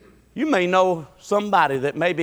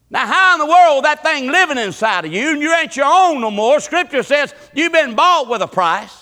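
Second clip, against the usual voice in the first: high, loud preaching cadence; preaching at full force; 9 semitones higher, 6 dB louder than usual. Acted. Preacher calling out to his congregation in full preaching voice.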